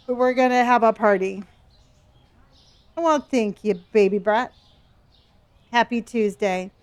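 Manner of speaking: normal talking voice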